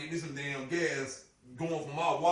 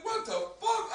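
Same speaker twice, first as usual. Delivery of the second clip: high, startled outburst; shocked; slower than usual. Retold or imitated. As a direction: blurt it out in disbelief.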